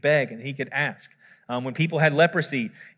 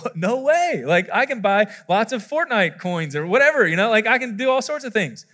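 higher-pitched, imitated wide-eyed kid; excited child; 5 semitones higher than usual. Child promised a million dollars, imitated excitement. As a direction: play a child thrilled by the promise. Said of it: "they'll be like".

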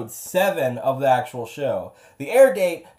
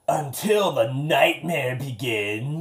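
slow gravelly pirate rasp; 3 semitones lower, much slower than usual. Acted. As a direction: growl it like an old pirate. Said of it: "Why do you sound like a pirate?".

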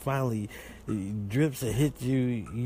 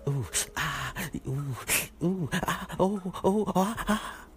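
climbing hammed-up moans, higher as they go; mock pleasure moans; 6 semitones higher, slower than usual. Shown as a demonstration. Husband showing off his wax fun, hammed-up rising moans. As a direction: ham up the ooh and ah, rising. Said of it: "make them sounds".

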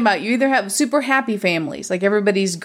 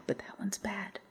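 whisper; 19 dB quieter than usual. Real, not acted. Confiding a harsh verdict aside.

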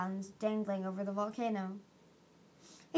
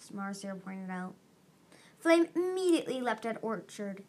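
plain reading voice

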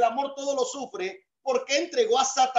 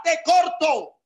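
defiant shout; 5 semitones higher, 5 dB louder, slower than usual. Real, not acted. Pastor's defiant punchline.

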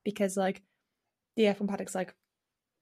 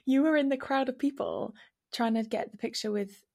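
normal talking voice

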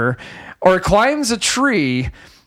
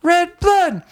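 high, drawn-out dreamy parody; mock-ecstatic girl; 10 semitones higher, much slower than usual. Imitating the girl savoring the blood rain, mock-ecstatic. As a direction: stretch each word slowly in a high put-on voice.

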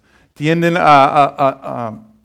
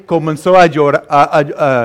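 ordinary talk

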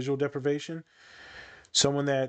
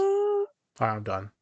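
sassy; slower than usual. Real, not acted. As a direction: toss it off with playful sass. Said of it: "I just feel sassy now".

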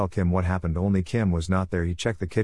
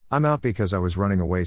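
ordinary delivery

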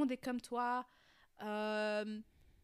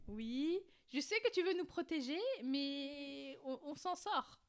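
higher, soothing voice for the dog; talking to a dog; 4 semitones higher, slower than usual. Real, not acted. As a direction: reassure the dog that all is fine. Reassuring the barking dog.

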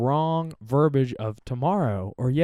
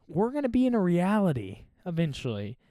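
usual speaking voice

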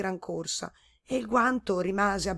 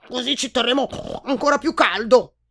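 louder gruff boar shoving his way in; pushy boar retort; 5 semitones higher, 8 dB louder than usual. A narrator's reading. Boar shoving into the glove, gruff. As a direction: barge in cheerfully as the boar. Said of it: "ribatté il cinghiale facendosi largo".

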